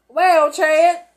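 loud, cheery TV weatherman; jovial weatherman. Acted. Weatherman greets the newsreader on air, hearty and loud. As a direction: boom it out like a chummy forecaster.

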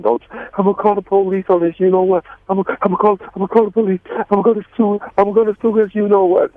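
hammed-up outrage at the attacker; retold fired-up threat. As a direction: swear to call the cops and sue. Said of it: "I said".

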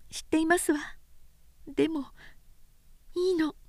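put-on a shy young woman welcoming him home; young woman's greeting. A narrator's reading. Oyuki greeting Sōshichi at home, gentle and high.

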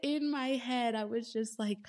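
normal talking voice

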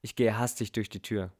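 usual speaking voice

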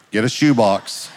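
ordinary talk

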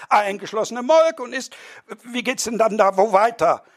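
high, excited squabbling voices; quarrelling dinner guests. Acted. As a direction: bicker like affronted bourgeois guests.

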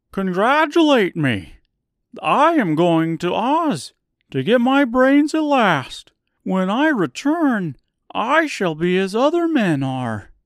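high, loud, eager scarecrow; bright straw man bursting with pride. Acted. Scarecrow's excited speech.

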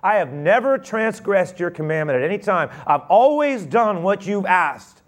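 high, loud, aggrieved protest; resentful older son protesting to his father; indignant son. Acted. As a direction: pour out years of resentment. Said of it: "older son says to his father".

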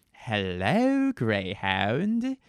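hammed-up bad German accent, slow and menacing; a goofy villain doing a phony German accent over the radio; silly fake German accent. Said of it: "like a silly man doing an impression of a German voice".

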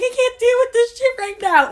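high and loud, at the end of her rope; retold screaming breakdown. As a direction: yell it out, overwhelmed. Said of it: "I'm, like, crying".

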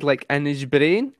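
usual speaking voice

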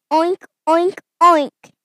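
loud, bouncy spoken oinks; punchy cartoon pig oinking. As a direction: call out three oinks like a pig. Piggy demonstrates pig greeting.

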